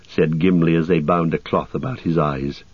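plain reading voice

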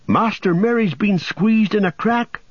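high, worried call; a touch of panic; urgent cry. A narrator's reading. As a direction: blurt it out in alarm. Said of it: "cried Sam".

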